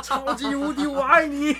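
high, loud yelled move name; attack name shout. Retold or imitated. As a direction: bellow the final move. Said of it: "然后".

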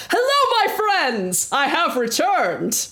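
loud, high heroic entrance; boisterous greeting. Acted. Leandros announcing his return to the tavern, booming and high. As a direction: call out grandly to the whole tavern.

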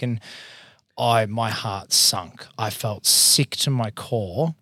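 ordinary talk, nothing marked

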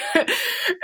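soft high laugh through tears; a real choked little laugh; teary laugh. Real, not acted. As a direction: laugh while fighting tears. Moved by the memory.

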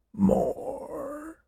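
thin, very soft, drawn-out whisper. A narrator's reading. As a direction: barely breathe it out, stretched long. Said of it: "Then, in a whisper".

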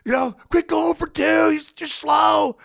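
high, slow, shouted heckle from the stands; a heckler yelling down at a slow runner, loud and mocking; heckling fan. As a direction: jeer it like a fan in the bleachers. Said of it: "the crowd's like".

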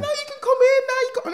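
high, loud imitation of mum inviting; mum's welcome. Imitating mum inviting people into the house, high and loud. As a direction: squeal it like a delighted mum.

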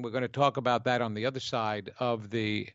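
normal talking voice

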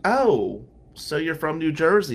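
retold brash retort; mock confrontational. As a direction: imitate her pouncing on the answer, loudly. Acted redhead confronting him about home.

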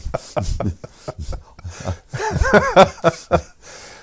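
long high laugh; hearty laugh. Real, not acted. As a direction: laugh out loud at the memory. End of his punchline.

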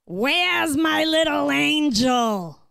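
high, slow, tender dad voice; dad greeting daughter. Retold or imitated. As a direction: sing it out like a loving father calling his girl. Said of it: "and then he goes".

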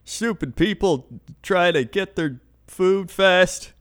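hammed-up grumpy old-timer; cranky old man. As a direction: grumble it out like a crotchety codger. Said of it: "Krender and I are official old men".

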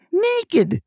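high yelled Naked!; imitating grandma's high yell. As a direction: shout Naked! high and falling.

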